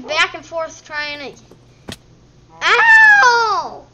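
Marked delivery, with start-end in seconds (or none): 2.6-3.9 high, loud "ow" yelp; sudden high cry. Real, not acted. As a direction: yelp as if hurt. Hurt in the game.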